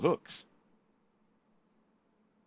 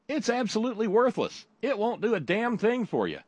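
much higher, cheerful pitchman voice; high announcer voice; 12 semitones higher, 3 dB louder than usual. Pretend. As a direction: chirp the bad news brightly. Ad admits product worthless.

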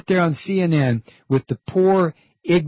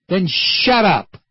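slow angry command; 2 semitones higher, 4 dB louder, slower than usual. Real, not acted. Host ends rant with command.

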